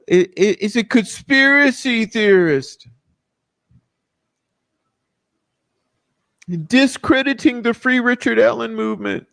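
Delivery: high, whiny mock of the critics. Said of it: "what does the prof say?".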